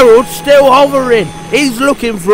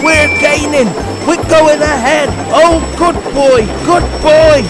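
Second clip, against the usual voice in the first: very high, loud cheering; excited shout; 12 semitones higher, 5 dB louder than usual. A narrator's reading. The driver cheers Percy on in the race, shouting. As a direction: cheer wildly as you pull ahead. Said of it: "he shouted".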